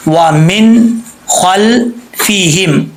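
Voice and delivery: ومن held with nun resting steady, no qalqala, slow chant; correct Quranic recitation. Shown as a demonstration. Teacher chanting ومن خلفہم correctly for the student. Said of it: "نون پہ جب آواز جائے تو جم جائے".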